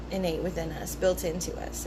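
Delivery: ordinary talk, nothing marked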